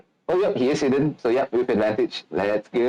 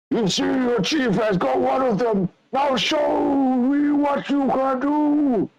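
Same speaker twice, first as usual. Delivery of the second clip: taunting monster boss. Acted. GM voices bugbear chef taunting.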